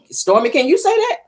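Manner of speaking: high, teasing call-out; shady taunt. Real, not acted. Host calling out Stormy, mocking and high. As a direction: needle Stormy with a mocking dare.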